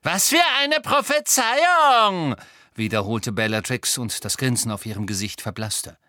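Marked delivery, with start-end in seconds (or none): 0.0-2.4 high, loud, drawn-out echo; incredulous villainess. A narrator's reading. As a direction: repeat it slowly in shrieking disbelief. Villain echoes question, incredulous.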